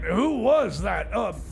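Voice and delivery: ordinary talk, nothing marked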